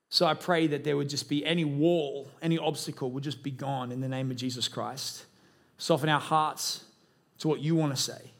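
low, reverent pastoral prayer; leading prayer. Real, not acted. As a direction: pray aloud for the room, low and unhurried. Leads congregation in prayer.

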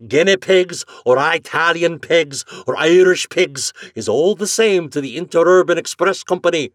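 loud Irish brogue; stubborn Irish agent. Acted. Flannery lecturing Morehouse in a loud brogue. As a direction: dig in with the brogue.

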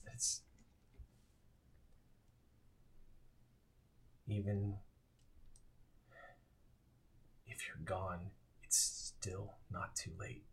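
the mayor pleading softly with his lost son; hushed plea. Acted. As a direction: press it gently, almost to yourself. The mayor squeezes his hands.

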